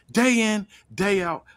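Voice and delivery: lightly voiced heel wrestler; voicing Jey. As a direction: start the promo big, then settle. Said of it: "looks at the crowd and says".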